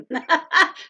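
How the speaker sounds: short, high burst of laughter; quick loud giggle. Real, not acted. Vlogger introducing her own channel, short laugh.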